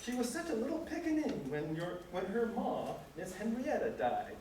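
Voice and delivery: hammed-up old servant in dialect accent, reminiscing; amateur reading of William's dialect accent; old servant's dialect accent. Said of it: "Excuse my accent".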